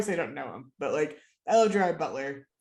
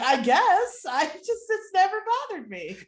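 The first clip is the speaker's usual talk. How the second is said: high-pitched, retold defensive reply; defensive shrug; 13 semitones higher, 5 dB louder than usual. Answering teasing about love blinders, defensive. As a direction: shrug it off in a raised voice.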